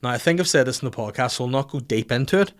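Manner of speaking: ordinary delivery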